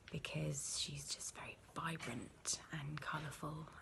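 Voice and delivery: soft whisper, barely voiced. Real, not acted. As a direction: keep it a hushed whisper. Whispered answers throughout.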